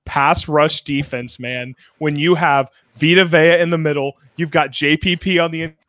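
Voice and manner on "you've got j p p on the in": normal talking voice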